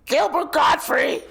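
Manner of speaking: high, loud, strained screech; Gilbert Gottfried. Retold or imitated. Naming the comedian by imitating him, high loud screech. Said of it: "Like, that guy".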